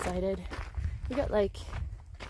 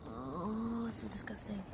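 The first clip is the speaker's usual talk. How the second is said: disgusted whisper; 11 dB quieter, slower than usual. Real, not acted. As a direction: whisper it, grossed out. Whispered at night creature.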